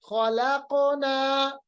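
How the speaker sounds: very slow chanted खलकना, q bounced back; qalqalah. Shown as a demonstration. Teacher brings the sound back, खलकना drawn out with bounced q. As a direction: stretch खलकना as "khalaa-qo-naaa", echoing the q. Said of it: "आवाज को मैं वापस ला रहा हूं".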